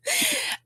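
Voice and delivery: high giggle; real high-pitched laugh. Real, not acted. Amused by own accent remark.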